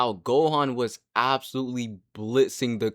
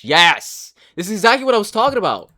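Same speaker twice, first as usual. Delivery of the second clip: loud, high and fast, triumphant; excited outburst; 8 semitones higher, 9 dB louder than usual. Real, not acted. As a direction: blurt it out like you just got proven right. Proving his point on video.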